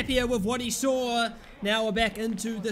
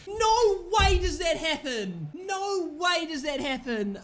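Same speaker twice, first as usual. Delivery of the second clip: laughing disbelief; 6 semitones higher, slower than usual. Real, not acted.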